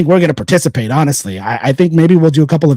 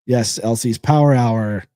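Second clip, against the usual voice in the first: normal talking voice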